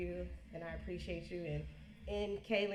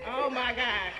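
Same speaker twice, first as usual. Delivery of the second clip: laughing exclamation; 5 semitones higher, 10 dB louder, slower than usual. Real, not acted. Laughing about nosy Bean.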